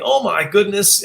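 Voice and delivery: put-on high, drawn-out New York accent. Sweet little woman to chiropractor in line, New York accent. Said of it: "in her New York accent".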